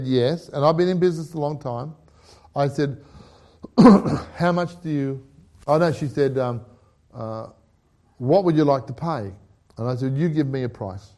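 ordinary talk, nothing marked

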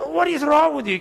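high-pitched mock scolding; exasperated wife. Wife questions husband's odd bank plan, exasperated. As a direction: imitate a wife telling off her husband.

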